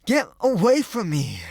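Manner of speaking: loud, high furious yell; angry shout. A narrator's reading. Boy ashamed before his friends, shouting at the girl. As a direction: shove her off with your voice. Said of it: "he shouted".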